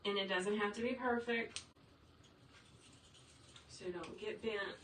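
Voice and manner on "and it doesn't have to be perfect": ordinary talk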